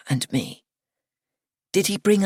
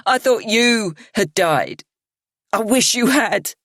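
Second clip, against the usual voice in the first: louder, higher, slow; shout; 5 semitones higher, 4 dB louder than usual. A narrator's reading. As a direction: shout it bitterly. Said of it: "she lifts her head and shouts".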